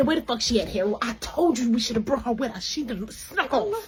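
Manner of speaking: soft worried dad voice; lightly voiced father muttering to his wife; hushed dad. Dad softly complains to mom, hushed worry.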